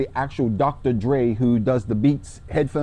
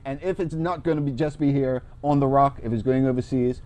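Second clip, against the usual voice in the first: ordinary talk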